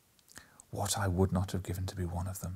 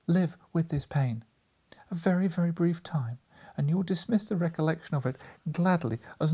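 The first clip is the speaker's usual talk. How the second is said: higher, sorrowful voice; sorrowful young woman; 6 semitones higher than usual. A narrator's reading. Girl recalls their old betrothal to him, higher and sorrowful.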